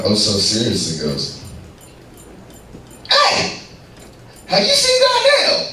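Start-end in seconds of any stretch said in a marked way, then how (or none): 3.1-5.7 very high, squeaky crackhead calling out. Retold or imitated. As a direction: pitch it way up like a frantic crackhead.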